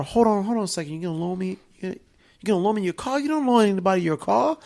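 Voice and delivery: put-on high, fast, disbelieving kid; amazed kid. Kid asking the gangster about the car, high and disbelieving. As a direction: play the stunned kid.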